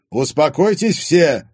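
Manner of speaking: loud, slow, stern command. A narrator's reading. Father silencing a family quarrel, stern and loud. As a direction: call the room to order firmly. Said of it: "строго проговорил отец".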